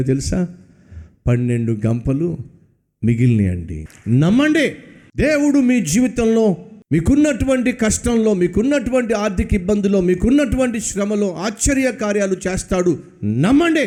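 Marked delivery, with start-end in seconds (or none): 4.1-13.8 raised, high ringing exhortation; preaching at full force. Acted. Preacher urging the congregation, impassioned. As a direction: urge the crowd to believe.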